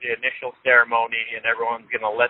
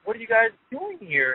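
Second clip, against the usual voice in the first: shocked, high disbelief; 9 semitones higher than usual. Retold or imitated. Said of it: "she was just in shock".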